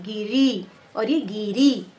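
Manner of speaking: गिरी clipped short, then गिरी slowly with a long final ई. Shown as a demonstration. Teacher contrasting short and long ई, slow गिरी. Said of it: "अधिक समय लग रहा है".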